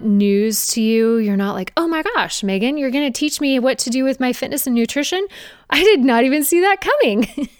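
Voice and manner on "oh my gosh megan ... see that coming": higher, hammed-up gasp of surprise; mock surprise. Viewer pretending to be amazed by Megan's advice, mock surprise. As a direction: play fake astonishment at old news.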